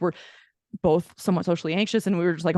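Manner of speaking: ordinary delivery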